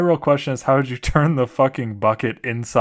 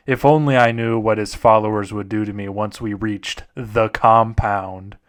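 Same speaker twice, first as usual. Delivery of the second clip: mock-serious scary story read; story narration; 4 dB quieter than usual. Reads a horror story aloud.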